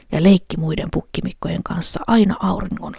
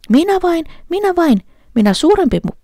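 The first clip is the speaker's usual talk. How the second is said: high, louder goat voice; middle goat; 13 semitones higher, 4 dB louder than usual. A narrator's reading. Middle goat answering the troll, high and eager. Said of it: "sanoi pukki".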